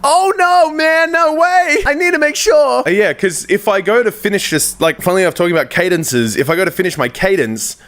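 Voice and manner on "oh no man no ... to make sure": very high squeaky little-guy voice; high-pitched voice. Shown as a demonstration. YouTuber attempting a little guy character, high-pitched voice. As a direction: push it way up high, drawn out. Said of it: "how to do a high-pitched voice".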